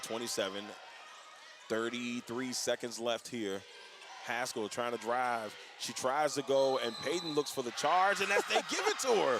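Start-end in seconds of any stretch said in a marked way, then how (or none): 5.8-9.4 excited play-by-play. Real, not acted. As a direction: build to the referee's call. Live call of a charge.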